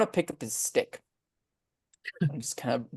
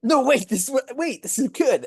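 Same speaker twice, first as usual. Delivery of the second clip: raised, louder insistence; excited urging; 11 semitones higher, 4 dB louder than usual. Acted. Halfling insists the spraying blood is good, excited urging. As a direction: urgently stop them with a raised voice.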